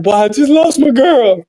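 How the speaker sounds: high, imitated tearful lament; mock boohoo crying. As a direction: imitate a friend wailing about a breakup. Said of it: "he boohoo crying".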